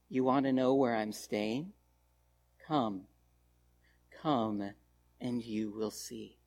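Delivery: soft, low and tender invitation; very gently, lovingly. Retold or imitated. Jesus inviting the hesitant disciples home, gentle and loving. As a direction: invite them in as if to a dear friend. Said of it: "very gently, very lovingly says".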